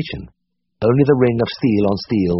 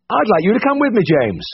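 higher brisk voice for Siegfried; Siegfried's voice, higher and brisk; 12 semitones higher than usual. A narrator's reading. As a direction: voice the brisk senior vet. Siegfried's voice held in reading.

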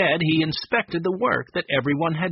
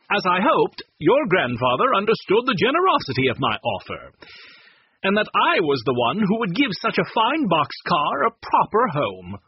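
a lightly raised, self-satisfied gentleman's voice; pompous Mr. Chessy; 6 semitones higher, 4 dB louder than usual. A narrator's reading. Pushy buyer boasting to the children, lightly voiced self-satisfied. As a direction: sound smug about the deal you made.

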